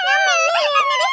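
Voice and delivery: high, loud, slow wailing sob; crying shout. Acted. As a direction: bawl it out like a hurt little kid. Child crying after being hurt. (ഉറക്കെ കരഞ്ഞുകൊണ്ട് വിളിക്കുന്നു)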